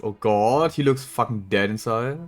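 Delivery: normal talking voice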